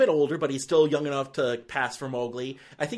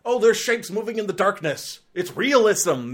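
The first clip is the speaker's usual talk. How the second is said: mock defensive filmmaker; 6 semitones higher, 5 dB louder than usual. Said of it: "it's like".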